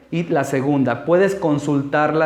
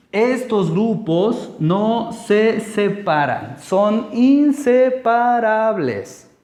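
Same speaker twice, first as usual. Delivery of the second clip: slow, higher, every syllable of "inseparables" stretched out; slow, raised-pitch teacherly emphasis on INSEPARABLES; emphatic rule; 5 semitones higher, slower than usual. Real, not acted. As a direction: hammer home "no se separan" and "inseparables" syllable by syllable. Teacher hammers home the rule.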